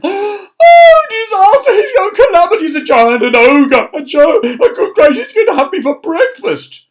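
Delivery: very high, loud panicked shriek; terrified wail. Acted. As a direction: shriek in mock panic at the giant. Terrified of the giant tramp.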